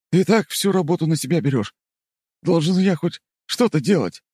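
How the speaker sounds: high, earnest insistence; insistent character voice. Acted. Kartopolov insists.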